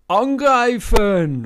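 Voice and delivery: very loud, very high, slow yell; the fat cook yelling to attack; battle cry. Acted. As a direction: scream the order. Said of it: "ruft er".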